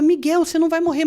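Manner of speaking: high, urgent insisting; frightened reassurance, retold. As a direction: insist through fear to a child. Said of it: "eu tava super assustada". (consolo aflito em voz aguda)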